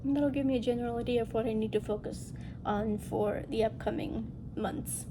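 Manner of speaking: ordinary talk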